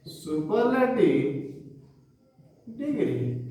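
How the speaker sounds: slow, word-by-word dictation of a rule. Shown as a demonstration. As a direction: spell the rule out slowly for students to copy. Teacher dictates rule slowly. (धीमे-धीमे लिखवाना)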